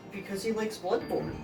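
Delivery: usual speaking voice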